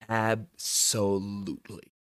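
slow, drawn-out deadpan yes; deadpan agreement. Retold or imitated. Drunk driver answering the cop, slow deadpan. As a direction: drag it out, owning it. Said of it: "I was like".

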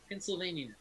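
usual speaking voice

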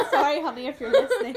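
giggling through the apology; laughing loudly while talking. Real, not acted.

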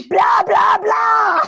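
three loud high equally stressed shouts; yelling. Shown as a demonstration. Joking demo of three stressed syllables to the cohost, yelling. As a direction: yell each BLAH at full stress. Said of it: "So it's like you're yelling".

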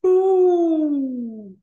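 high, loud, drawn-out "ooh"; a delighted, impressed hoot. Real, not acted. Co-host reacts to the nasty pass-rush package, high impressed ooh.

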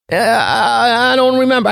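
put-on high, quavering old man's voice; confused old man. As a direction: play a befuddled eighty-five-year-old. Confused 85-year-old on phone.

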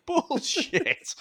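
bursts out laughing, high and loud; cracking up while scoffing; laughing outburst. Real, not acted. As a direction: laugh the word out in disbelief. Laughing disbelief at dialect coach.

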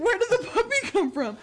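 high, laughing question; laughing through the words. Real, not acted. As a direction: ask it while cracking up. Retelling family puppy confusion, laughing.

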